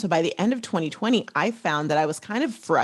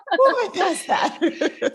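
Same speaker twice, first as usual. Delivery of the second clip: slow, high words through laughter; laughing; 12 semitones higher, much slower than usual. Real, not acted. Guest still laughing as she asks who would pass, slow and high. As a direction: get it out while still laughing. Laughing at Mickey Mouse answer.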